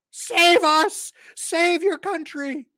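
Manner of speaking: high, loud theatrical appeal; high-pitched hammed-up begging; mock desperate plea. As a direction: plead melodramatically to be saved, drawing it out. Mock plea to Vivek for rescue.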